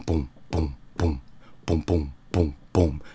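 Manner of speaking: usual speaking voice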